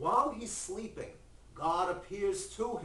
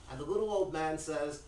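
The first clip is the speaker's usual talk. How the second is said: ordinary delivery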